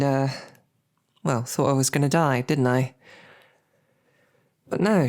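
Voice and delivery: ordinary talk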